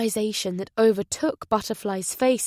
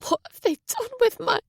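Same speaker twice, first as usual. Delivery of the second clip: much higher, cut off in panic; desperate demand; faster than usual. Acted. Butterfly to Jack, frantic cut-off demand. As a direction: demand it frantically then break off. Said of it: "she demanded to know".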